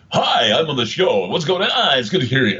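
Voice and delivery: high, bright over-the-top DJ delivery; cheesy radio announcer puking voice. Shown as a demonstration. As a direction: push it out like a hammed-up morning jock. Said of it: "they do something called puking".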